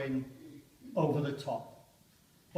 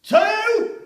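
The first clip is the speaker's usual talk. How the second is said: much higher, loud distant yell; far-off officer's shout; shouted count; 15 dB louder than usual. Acted. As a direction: bellow the number down the line. Countdown called in trench.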